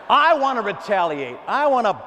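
loud, high-pitched demand; worked-up, full-voiced protest; acted vengeful outburst. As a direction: shout it like someone set on payback. Acts out person refusing to forgive.

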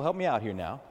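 ordinary talk

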